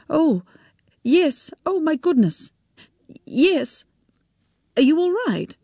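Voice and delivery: high, flustered woman suddenly remembering. A narrator's reading. She greets Tim Bates, flustered and high. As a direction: caught off guard, recovering politely.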